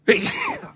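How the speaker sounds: sudden loud sneeze; sneezing. Real, not acted. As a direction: sneeze hard mid-sentence. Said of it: "Sorry about the sneeze attack".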